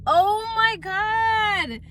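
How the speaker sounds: teary excitement. Real, not acted. Showing palette to viewers.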